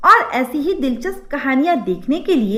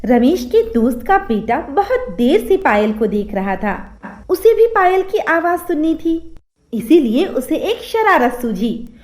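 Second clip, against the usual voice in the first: ordinary delivery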